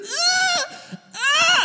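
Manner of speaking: high, loud retold shrieks; mock panicked scream. Boy with glass in his forehead screams in panic. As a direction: scream like a terrified little kid. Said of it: "literally screaming like a little".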